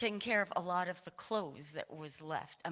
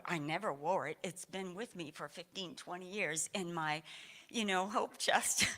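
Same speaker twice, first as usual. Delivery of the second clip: ordinary talk, nothing marked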